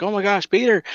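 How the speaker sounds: Mary Jane sees Peter hurt; alarmed gasp. Retold or imitated. Mary Jane finding wounded Peter.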